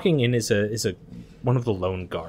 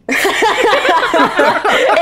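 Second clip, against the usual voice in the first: loud high burst of laughter; 8 dB louder than usual. Real, not acted. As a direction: crack up loudly. Big laugh at the joke.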